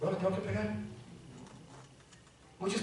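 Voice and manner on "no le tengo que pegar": mock apologetic. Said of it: "estoy así como diciendo".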